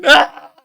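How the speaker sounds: high, loud mock yelp breaking into laughter; comic shriek of fright through laughing; hammed-up jump-scare yelp; imitated startled scream. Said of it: "His reaction".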